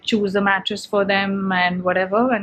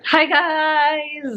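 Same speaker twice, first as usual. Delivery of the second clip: blocked nose, stuffy and nasal; 7 semitones higher than usual. Real, not acted. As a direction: talk through a stuffed-up nose. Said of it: "I sound so nasal".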